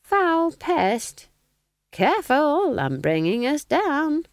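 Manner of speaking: put-on high, slow, sly old woman; sly smiling innocence. A narrator's reading. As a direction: feign innocence through a smile, pitched high, slow. Nanny deflects.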